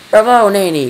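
high, loud cheer of applause; delighted cry. A narrator's reading. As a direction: cry out bravo to the nanny. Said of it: "cried the president".

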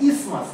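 ইসমাস said "IS-mas", with no t or th; correct pronunciation. Shown as a demonstration. Teacher tells class to listen to the word, correct pronunciation. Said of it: "কান করে শোনো".

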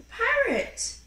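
high, eager announcing; excited reveal. Acted. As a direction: show the child with delight.

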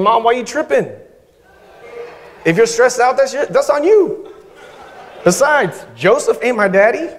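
high, mock teen voice, quick at the end; sassy teenager. Preacher imitating teenage Jesus sassing Mary, high and mocking. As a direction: play a mouthy kid back-talking his mom. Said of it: "Jesus is basically saying".

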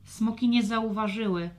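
plain reading voice

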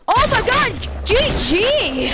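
high, loud shocked yell; excited shout. Real, not acted. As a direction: scream it at the loss. Loses the fight, reacts.

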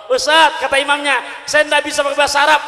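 high, loud, fast protest; protesting imam. Retold or imitated. Imam protests to the ustadz he cannot speak Arabic, high flustered shout.

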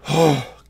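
loud, winded sigh; tired sigh. Real, not acted. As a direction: heave a big exhausted sigh. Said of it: "out of breath and tired".

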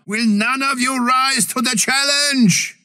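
high, shouted challenge; high shouted taunt to the enemy. Acted. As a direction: call them out boldly.

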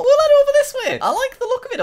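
high falsetto, sing-song; squeaky falsetto. Acted. Gamer retreating from lava monsters in a high squeaky voice. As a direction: chirp it like a cartoon.

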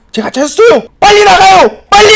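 high, urgent yell; too-loud shouting. Shown as a demonstration. Woman announcing the escape route, shouting too loudly. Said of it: "너무 크게 얘기해".

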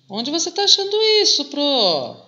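high, quick pupil asking the teacher; mock student question. Teacher voicing a student asking where to find it, high and quick.

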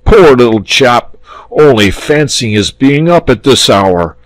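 blustering dragon's concern; indignant sympathy. A narrator's reading. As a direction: exclaim over the poor child. Dragon suddenly fussing over boy.

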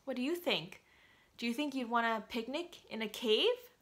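ordinary delivery

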